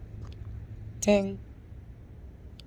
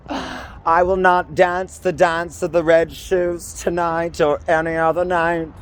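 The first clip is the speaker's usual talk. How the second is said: dramatic diva; 6 semitones higher than usual. Acted. Dramatic quoted declaration.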